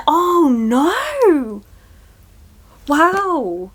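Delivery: slow, drawn-out "oh no" of realisation; as if a plot twist just clicked; sudden realisation. Real, not acted. Sudden realisation mid-vlog.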